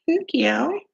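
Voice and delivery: high, lilting thank you; cute thank-you. Shown as a demonstration. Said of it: "That's how I say thank you".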